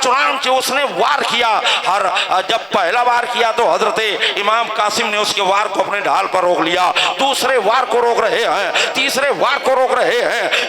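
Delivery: full-throated, high-pitched combat narration; rousing battle preaching. Acted. Preacher recounts the duel to the congregation, rousing battle preaching. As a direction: declaim the fight with driving force.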